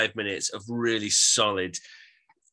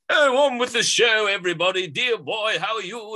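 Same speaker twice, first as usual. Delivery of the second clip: high, sing-song plummy English showman; jokey plummy English, pitched way up; plummy English accent; 11 semitones higher, 5 dB louder than usual. Retold or imitated. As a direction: mimic a half-spoken, rhythmic plummy English vocal. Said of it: "in a plummy English accent".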